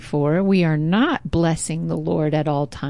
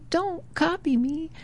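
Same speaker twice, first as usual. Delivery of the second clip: the same words much softer, fading; faint echo; 9 semitones higher, 6 dB quieter than usual. Retold or imitated. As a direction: throw it back as a distant echo. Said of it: "So he's hearing the echo".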